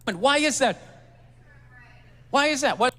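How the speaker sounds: loud, demanding repeat. Acted. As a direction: hammer the question at the crowd. Comedian presses the crowd.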